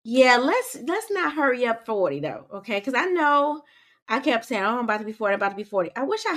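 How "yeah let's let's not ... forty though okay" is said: normal talking voice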